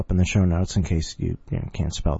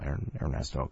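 ordinary delivery

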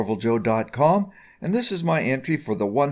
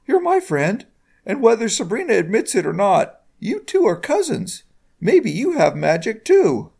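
very high, earnest character voice; Bilbert; 10 semitones higher, 4 dB louder than usual. A narrator's reading. Friend claims her as his own, high-voiced. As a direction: reassure your friend in a high-pitched voice.

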